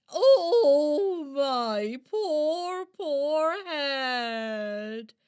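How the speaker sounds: loud groaning Oh, then a moaning lament; sore-headed moaning. Acted. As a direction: moan like your head is pounding. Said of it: "someone with a headache".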